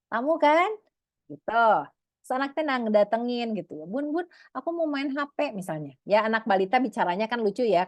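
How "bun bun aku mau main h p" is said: put-on child voice. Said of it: "kalau aku mah kan nggak lucu ya".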